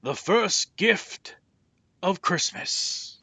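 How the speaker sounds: slow, booming high call to the crowd. A narrator's reading. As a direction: Santa announcing to the cheering crowd. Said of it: "and called out".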